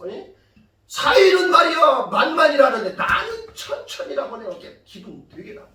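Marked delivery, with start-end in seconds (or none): 0.9-4.5 loud, higher-pitched acted indignation; indignant grumble. Saul resents his son-in-law's greater praise, indignant grumble. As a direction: protest the slight, pushing the opening hard. Acting Saul's offended reaction.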